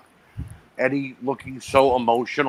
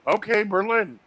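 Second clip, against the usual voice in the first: mock surprise; 7 semitones higher than usual. Realizing the name was Berlin.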